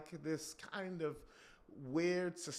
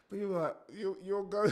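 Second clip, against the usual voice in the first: normal talking voice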